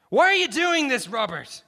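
high shout easing on the name. A narrator's reading. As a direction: shout the question across to him. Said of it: "Garrison shouted".

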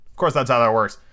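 ordinary delivery

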